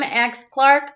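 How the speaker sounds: usual speaking voice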